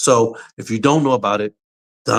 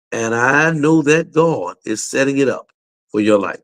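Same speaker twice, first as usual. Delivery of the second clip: normal talking voice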